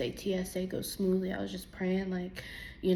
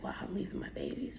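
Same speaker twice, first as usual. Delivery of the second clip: whispered; 6 dB quieter, faster than usual. Retold or imitated. Retold tearful thought at departure.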